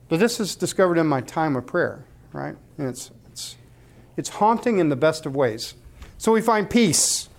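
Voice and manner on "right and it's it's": ordinary talk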